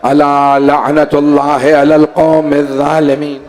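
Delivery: slow, chanted closing invocation; solemn liturgical recital; Arabic recitation. Real, not acted. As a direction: intone the verse slowly with long vowels.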